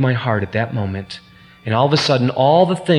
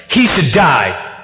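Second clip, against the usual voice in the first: outraged verdict; 3 semitones higher, 4 dB louder, slower than usual. Retold or imitated. David's outraged verdict retold.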